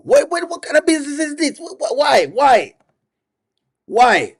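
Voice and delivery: high-pitched, stammering disbelief; exasperated protest. Pretend. Host protesting to the chat about this business, exasperated. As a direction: demand an explanation, half in earnest.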